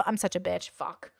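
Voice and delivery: usual speaking voice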